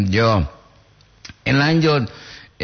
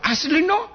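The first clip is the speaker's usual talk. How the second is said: "Asli no" pitched very high; high-pitched tune on "Asli no"; questioning intonation. Retold or imitated.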